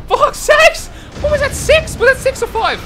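very high, loud shocked yelling; alarmed disbelief. Real, not acted. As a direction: shout it in panicked disbelief. Alarmed at six enemies appearing.

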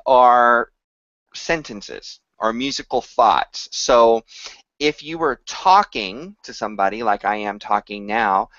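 ordinary delivery

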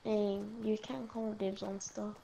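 normal talking voice

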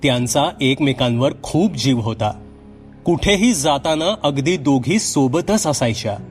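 ordinary delivery, nothing marked

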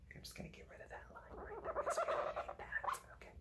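fast whisper to herself; hushed quick aside. Real, not acted. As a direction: whisper it while fixing the page. Aside while erasing.

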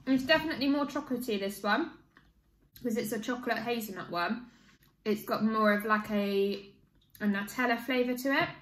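speaking between bites; chewing while talking. Real, not acted. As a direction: mouth partly full of food. Seen 5: Bites bar at -2; mouth still working the bite while speaking.

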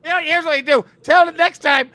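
loud, high-pitched mock tirade; ranting doctor. Doctor telling patient what to tell his girlfriend, mock tirade. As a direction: lay down orders in comic outrage.

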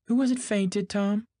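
high, loud woman's question; calling out. A narrator's reading. Daisy calls question to Tom.

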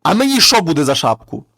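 the dead man bursts out in a loud shout; sudden shout. Acted. Corpse shouts at the thieves to scare them off, loud. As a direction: yell it out of nowhere to scare the thieves. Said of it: "А той мертвий крикнув".

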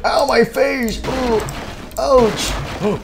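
high, slow hammed-up yelp of pain; mock pain cry. Boxer hit in the face, high hammed-up ow. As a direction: squeal like you just got smacked.

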